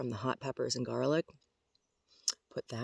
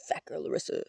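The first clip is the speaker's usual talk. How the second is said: a hint of a squirrel voice; mock squirrel taunt at usual pitch; half-voiced animal teasing; lightly voiced squirrel; 3 dB louder than usual. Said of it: "Squirrel says".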